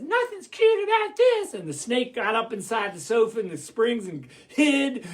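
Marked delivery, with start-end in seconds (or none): 0.0-1.6 very high little chipmunk voice. Acted. Chipmunk watching the wolf at the nozzle, high squeaky voice. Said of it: "the chipmunk says".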